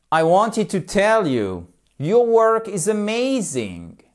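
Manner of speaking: slow, high swoops on "tell" and "your work is amazing"; English intonation model. Shown as a demonstration. Teacher having students repeat, slow exaggerated English melody. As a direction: model each English word slowly for repetition. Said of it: "C'est juste pour vous faire répéter".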